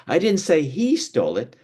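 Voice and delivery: "he" pitched high and held; single out "he" for contrast; stress on HE. Shown as a demonstration. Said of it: "way I say the, each word".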